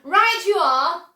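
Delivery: loud, slow and emphatic; cried out. A narrator's reading. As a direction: call it out with delight. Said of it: "cried Mister Wonka".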